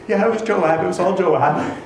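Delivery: terrified. Retold or imitated. Woman caught by the king, nervous admission. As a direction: blurt it out as if caught. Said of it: "I imagine she's terrified".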